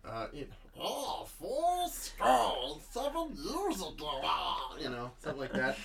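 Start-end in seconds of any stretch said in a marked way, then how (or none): 0.8-4.2 high, slow alien-creature impression; Stitch. Co-host doing Lincoln's part on request, high Stitch voice. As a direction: drag it out in a high cartoon creature voice. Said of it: "can you give us some of that".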